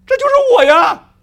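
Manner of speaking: high, loud exclamation; excited realization. Acted. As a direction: burst out in discovery. Sudden discovery in the Bible.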